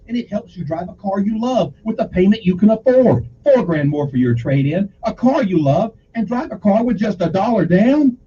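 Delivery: punchy dealer pitch voice; car ad read. Acted. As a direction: sell it like a TV car ad.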